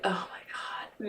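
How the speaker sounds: slow, breathless whisper; whispered dread. Retold or imitated. Mother realizing her son deals drugs, slow whisper. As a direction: breathe it out in horror.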